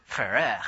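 Ferrer with a French-style, end-stressed finish; French-style pronunciation. Shown as a demonstration. Showing the audience how they pronounce it, Ferrer in French style. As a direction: say Ferrer as an exaggerated French name. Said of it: "and they pronounce it Ferrer".

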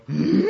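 a puzzled, rising dog-like hmm; Scooby-Doo hmm. Retold or imitated. Telling host about baffling pastor, puzzled Scooby-Doo hmm. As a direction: play dumb like a cartoon dog. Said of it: "that's when I do a Scooby-Doo and I go".

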